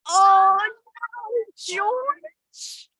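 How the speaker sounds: high, wailing cat voice; a frightened cat crying out; cat's cry. A narrator's reading. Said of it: "says the cat".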